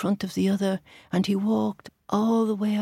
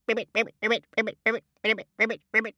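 croaky ribbit chant; frog ribbit; 5 semitones higher, much faster than usual. Shown as a demonstration. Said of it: "the laughing of a frog would go".

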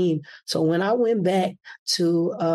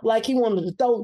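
ordinary talk, nothing marked

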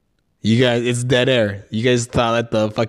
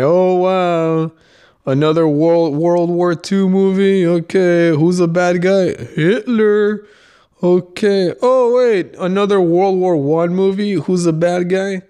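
mock-bored, pitched up, sarcastic drag; sarcastic boredom; 6 semitones higher, slower than usual. Podcaster mocking repetitive war movies, sarcastic and bored. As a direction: roll your eyes at every Hitler movie.

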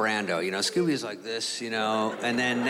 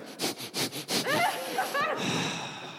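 long weary sigh; heavy sigh; 4 dB quieter than usual. Retold or imitated. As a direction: sigh like it's all too much. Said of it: "he's like".

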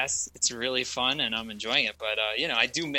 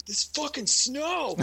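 high slow grumpy outburst; crotchety dad rant; 13 semitones higher, slower than usual. Retold or imitated. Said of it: "the other day I was like".

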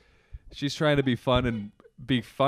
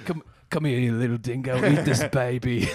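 mock Aussie coaxing; Australian accent. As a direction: beckon in a put-on Australian accent. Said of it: "do it Australian".

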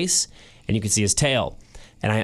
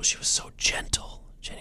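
words barely voiced, choked up; choked whisper; 7 dB quieter than usual. Real, not acted. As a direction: lose the voice on the memory. Voice breaks while retelling.

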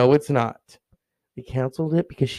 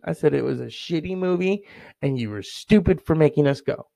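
ordinary talk

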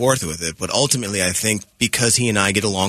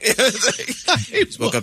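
high laughing mock of being woken; laughing groggy; 12 semitones higher than usual. Host woke him at night, laughing. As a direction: laugh through a sleepy reply.

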